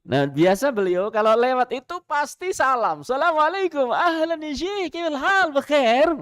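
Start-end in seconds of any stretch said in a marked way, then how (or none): 3.1-6.1 bright, much higher, cheerful Arabic greeting from the preacher; imitating a friendly greeting. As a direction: greet the security guard warmly, pitched well up.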